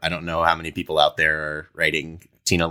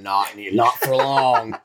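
slow, laughing cowboy threat; old prospector; 3 semitones higher, much slower than usual. Pretend. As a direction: menace the appliance like an old gunslinger. Cowboy threatens washing machine.

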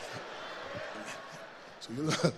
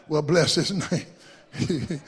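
slow preacher drawl; 5 semitones lower, 4 dB quieter, much slower than usual. Acted. Drawn-out church exclamation.

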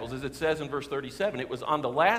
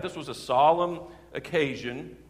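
ordinary talk

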